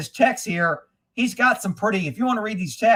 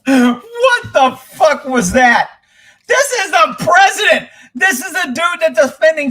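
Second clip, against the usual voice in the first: laughing disbelief; 6 semitones higher, 7 dB louder than usual. Real, not acted. Reacting to a president's gaffe clip.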